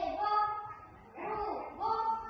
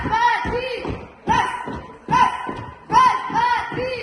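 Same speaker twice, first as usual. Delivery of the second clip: higher, very loud cadence chant; shouted marching count; 5 semitones higher, 14 dB louder, slower than usual. Real, not acted. Squad marching, counting cadence loudly.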